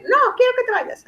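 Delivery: put-on high childish no, go away. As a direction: play the child pushing mom out. Daughter quoted telling mom leave.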